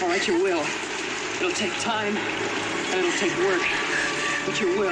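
loud, elated teaching; elated teacher calling out over the rain; jubilant. Real, not acted. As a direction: shout it happily through the rain.

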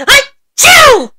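high, loud explosive achoo; hammed-up sneeze; big sneeze. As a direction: let out a huge sneeze.

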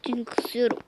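whispered aside. Real, not acted. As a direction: breathe it without voice. Says he feels sick.

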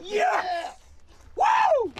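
high, thrilled whoops of relief; excited cheering. Real, not acted. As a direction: celebrate finding the key. Finds lost key, cheers.

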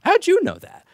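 high-pitched mock astonishment; retold surprise. Coworker caught out about the second account, mock astonished. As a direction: act out being caught out, voice leaping up.

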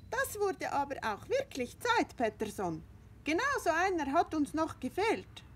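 high, chattering hen voices; gossipy hens fussing over the new rooster; cackling hens. Acted.

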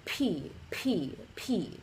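P with a big puff of air; aspirated P. Shown as a demonstration. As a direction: push lots of breath through the P. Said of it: "push a lot of air through".

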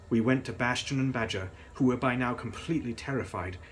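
ordinary delivery

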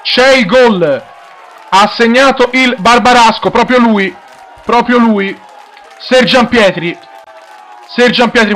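high, loud goal call shouted breathlessly; breathless italian goal commentary. Real, not acted. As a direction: scream the goal, rising with excitement. Commentator calls a goal live.

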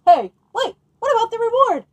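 high, loud, protesting; shrill loud "hey, wait" protest; indignant interruption. Acted. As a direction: jump in, outraged you were cheated. A listener voice interrupts the ending.

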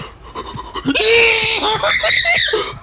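high burst of laughter after a scare; shrieking laugh. Real, not acted.

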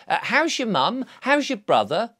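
cheery neighbour asking after family; chirpy inquiry. Retold or imitated. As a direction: ask after the family brightly. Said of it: "I will say".

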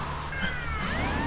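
soft, very high laugh; high giggle. Real, not acted. Player laughs over two stars, soft high giggle. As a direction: giggle at your own innocence.

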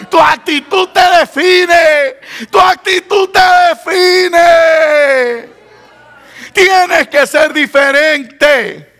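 high, drawn-out exhortation to the congregation; full-force preaching. Acted. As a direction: build to a fervent cry and stretch the endings. Climax of the sermon.

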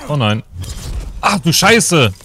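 loud, high shocked curse; panicking gamer. Real, not acted. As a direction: shout it in sudden horror. Character hit in the game.

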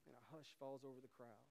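hushed narration. Real, not acted. Describes hush over crowd.

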